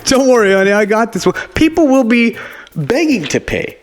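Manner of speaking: high, bold swaggering guy; smug husband. Pretend. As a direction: brag to your honey. Mock confident husband.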